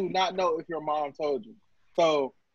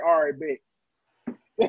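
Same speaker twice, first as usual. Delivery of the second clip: drawn-out bet; 4 semitones lower, much slower than usual. Retold or imitated. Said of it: "just like".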